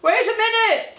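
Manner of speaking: high, loud urgent shout; frantic loud halt; urgent call. Acted. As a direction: stop the fleeing dogs. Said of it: "said Clive to the panicking mutts".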